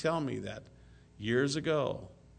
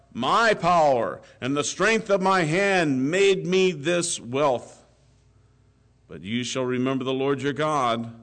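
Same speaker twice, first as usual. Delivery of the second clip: scripture reading; 6 dB louder than usual. Acted. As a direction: proclaim the verses from the pulpit. Reads scripture aloud in sermon.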